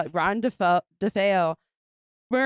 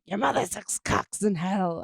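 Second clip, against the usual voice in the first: slow menacing demon voice; lightly voiced demon. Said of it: "a demon's like".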